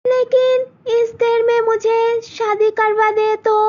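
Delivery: high, pleading daughter voice; pleading young girl. Acted. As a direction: beg her mother not to agree. Malti worried.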